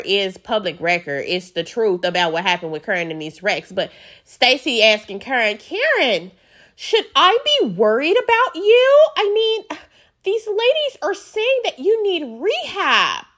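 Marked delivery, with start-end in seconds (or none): 5.6-13.2 high, polished news anchor voice; newscaster voice. Retold or imitated. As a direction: read it like a TV anchor. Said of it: "newscaster voice".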